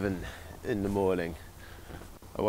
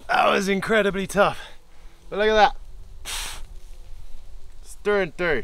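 elated relief; 9 semitones higher, faster than usual. Real, not acted. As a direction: crow with triumph at the finished cut. Exhausted after cutting through tree.

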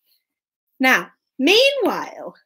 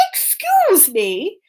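high, slow, grand king; drawn-out royal inquiry; storybook king; 9 semitones higher, slower than usual. Acted. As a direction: call out regally, stretching each word. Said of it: "and the king did the same".

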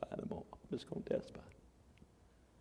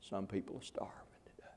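softened hush, quick; hushed solemn reminder; whispered; 7 semitones lower, 14 dB quieter, faster than usual. Real, not acted. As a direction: drop to a sad whisper.

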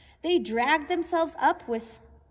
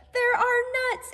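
higher, eager mock exclaim; 7 semitones higher than usual. Bruce the squirrel crying after the lost cone, high exclaim. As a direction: blurt it out in dismay. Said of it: "exclaimed Bruce".

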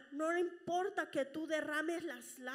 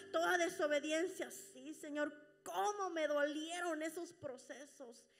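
normal talking voice

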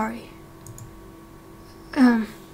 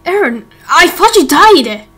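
shocked reunion; 3 semitones higher, 7 dB louder than usual. Acted. Reunion with friend thought dead.

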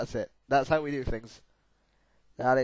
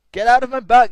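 loud, higher-pitched outburst; annoyed yell; 9 semitones higher, 14 dB louder, faster than usual. Real, not acted. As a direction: yell it at the obstacle, high and loud. Yelling at glass in game.

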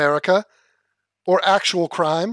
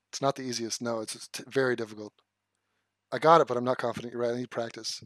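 ordinary talk

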